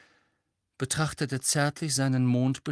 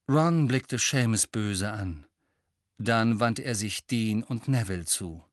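ordinary delivery

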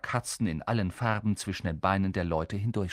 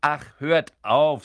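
slow, louder exasperated brush-off; annoyed; 4 semitones higher, 8 dB louder, slower than usual. Acted. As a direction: wave off your brothers. Percy annoyed.

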